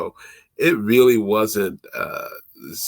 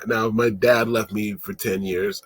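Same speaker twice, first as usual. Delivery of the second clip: normal talking voice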